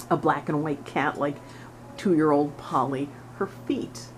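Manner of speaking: ordinary talk, nothing marked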